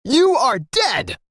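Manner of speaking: high, very slow drawn-out threat; furious threat. A narrator's reading. Narrator voices bully threatening the boy, high drawn-out threat. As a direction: stretch each word out like a cartoon villain.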